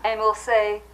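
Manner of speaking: normal talking voice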